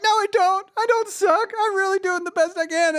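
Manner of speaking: very high, defensive plea; pleading protest. Acted. Man defending himself to the monster in his head, pleading. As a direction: plead back in a high little voice. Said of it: "No, I'm like".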